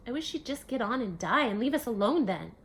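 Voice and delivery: put-on loud, bitter child's outburst; angry outburst. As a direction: spit it out in hurt fury. Child lashes out after rejection.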